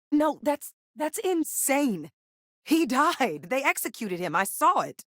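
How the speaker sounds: high-pitched, stunned denial; distressed disbelief. Acted. Refusing news of an execution, high distressed disbelief. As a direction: protest in a high, drawn-out voice.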